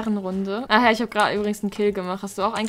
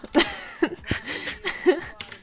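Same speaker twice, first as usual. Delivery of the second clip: breathy giggle; 8 semitones higher, 4 dB quieter than usual. Real, not acted. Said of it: "ich muss die ganze Zeit lachen".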